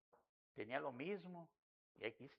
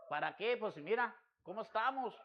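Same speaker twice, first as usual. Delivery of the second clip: wife's retort; 7 semitones higher, 5 dB louder than usual. Retold or imitated. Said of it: "Y me dice".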